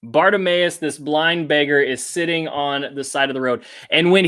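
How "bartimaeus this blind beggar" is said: normal talking voice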